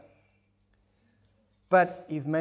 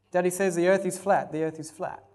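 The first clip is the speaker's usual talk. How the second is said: normal talking voice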